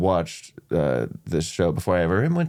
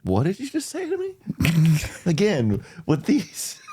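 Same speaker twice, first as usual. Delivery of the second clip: mock outrage; 10 semitones higher than usual. Guest pretending offence at the host's straw jab, mock outrage. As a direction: act scandalised by the question.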